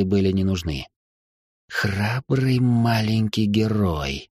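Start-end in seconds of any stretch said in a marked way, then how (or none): 1.7-4.3 slow sneering hiss. Acted. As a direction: hiss it slowly, mocking the hero. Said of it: "шипящего голоса".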